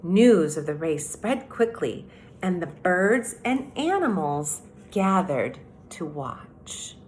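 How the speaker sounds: storybook read-aloud, unhurried and clear; reading to children. A narrator's reading. Teacher reading The Tortoise and the Hare to third graders, storytelling. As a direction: read it like story time on the rug. Reads fable aloud to class.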